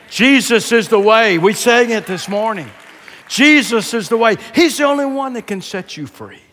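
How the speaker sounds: loud, high-pitched preacher's proclamation; full-force preaching. Real, not acted. Preacher urging people to turn to Christ, full force.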